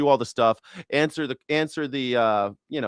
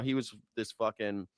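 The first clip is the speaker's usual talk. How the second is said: ordinary delivery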